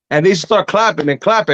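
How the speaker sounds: usual speaking voice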